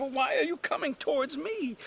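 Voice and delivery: high-pitched, bewildered question; bewildered girl. Acted. Startled girl as ducks approach.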